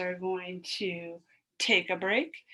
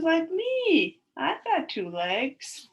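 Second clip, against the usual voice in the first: higher and louder proud lion voice; the lion boasts, pitched up; 7 semitones higher, 4 dB louder, slower than usual. Acted. Teacher voicing the lion for the children, higher and louder. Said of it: "And lion says".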